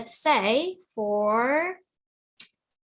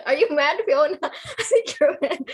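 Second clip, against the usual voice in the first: laughing tease; 4 semitones higher, 3 dB louder than usual. Real, not acted. Teasing student through laughter.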